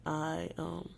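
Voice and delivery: normal talking voice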